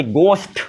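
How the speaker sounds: "गोश्त" drawn out as gost; silent H. Shown as a demonstration. Teacher answers own question for students, silent H in "गोश्त". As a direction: open "गोश्त" on unaspirated G. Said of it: "इसे सिर्फ क्या बोलेंगे".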